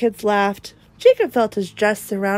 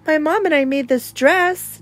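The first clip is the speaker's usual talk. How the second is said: put-on proud child; 7 semitones higher than usual. A narrator's reading. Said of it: "Jacob said proudly".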